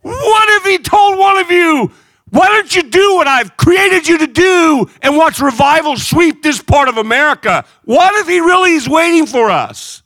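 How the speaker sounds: high, loud cry of a preacher; preaching shout. Acted. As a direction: shout the challenge, near tears. Preaching at full force.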